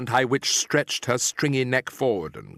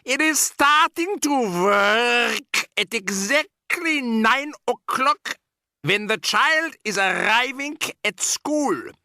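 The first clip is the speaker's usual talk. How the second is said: triumphant shout; 11 semitones higher, slower than usual. Acted. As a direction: crow it out in triumph. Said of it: "shouted the Grand High Witch triumphantly".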